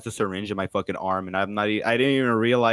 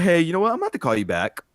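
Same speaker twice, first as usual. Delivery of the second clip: hurried hang-up; 9 semitones higher, 5 dB louder, much faster than usual. Retold or imitated.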